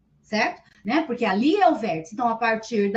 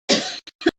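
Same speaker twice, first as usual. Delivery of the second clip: sudden cough after choking. Real, not acted. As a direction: cough as if water went down wrong. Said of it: "é que engasguei aqui".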